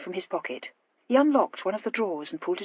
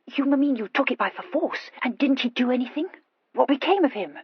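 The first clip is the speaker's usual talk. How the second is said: higher, stammering questions; incredulous Horatia; 6 semitones higher than usual. Acted. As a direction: question him in stammering disbelief.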